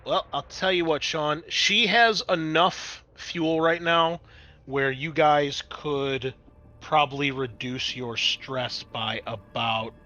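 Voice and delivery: ordinary delivery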